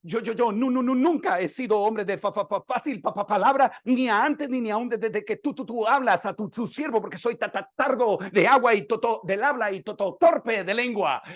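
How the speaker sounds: trips over every other syllable; stuttering Moses. Acted. Moses stammering his excuses to God, a timid stutter. Moses pleads, slow of speech.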